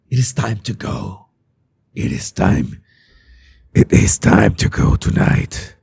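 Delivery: low, hushed, rasping superhero whisper; Batman. Acted. Host tells viewers it is time to go, low whispered Batman voice. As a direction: sink into a dark, husky vigilante voice. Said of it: "That's my Batman voice".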